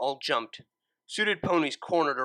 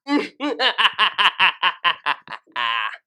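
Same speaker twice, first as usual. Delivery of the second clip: high, loud villainous cackle; maniacal laugh; 6 semitones higher, 9 dB louder than usual. Acted. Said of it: "laughed maniacally".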